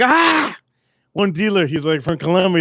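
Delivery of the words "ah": shocked yelp. Retold or imitated. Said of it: "all the dealers are like".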